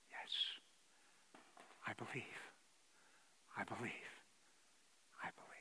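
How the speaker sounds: breathy hushed repeats; whisper. Acted. Preacher voicing a believer's quiet confession, whispered. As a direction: whisper it like a private vow.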